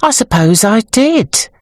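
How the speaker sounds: pensive, dawning agreement; thoughtful. A narrator's reading. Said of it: "said Sybil thoughtfully".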